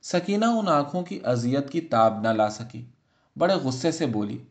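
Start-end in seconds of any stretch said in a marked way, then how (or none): none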